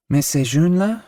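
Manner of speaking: high, doubtful French lady; a prim lady peering at the young ones; high, skeptical French question; landlady's question. A narrator's reading.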